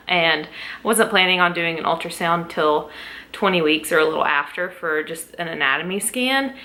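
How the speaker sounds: normal talking voice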